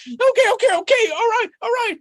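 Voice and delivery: very high, loud squeaky okays; Rabbit resigning. Acted. Hungry rabbit gives in, very high and squeaky. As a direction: talk yourself into it, high and squeaky.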